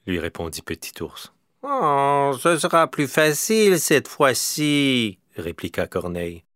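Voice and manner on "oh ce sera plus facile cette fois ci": higher, slow reassuring crow; coaxing crow. A narrator's reading. Crow reassuring the bear before the climb, high and slow. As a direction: coax the bear gently up high.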